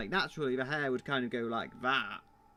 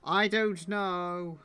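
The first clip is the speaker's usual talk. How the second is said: slow, louder, higher "I don't know"; drawn-out frustrated; 5 semitones higher, 5 dB louder, much slower than usual. Real, not acted. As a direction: stretch it out in exasperation. Frustrated while fixing animation hair.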